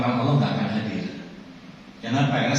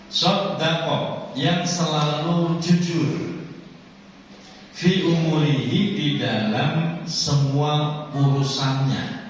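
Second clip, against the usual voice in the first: slow preaching; 5 semitones higher, slower than usual. Acted. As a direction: stretch each phrase slowly, high. Slow preaching explanation of hadith. (ceramah pelan dan tinggi)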